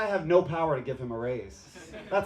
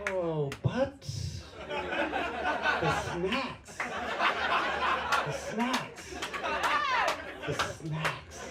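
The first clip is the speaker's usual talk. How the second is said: higher, drawn-out swooning over each word; dreamy swoon; 7 semitones higher, 4 dB quieter, much slower than usual. Acted. A swiper swoons over a mom's snacks, slow and dreamy.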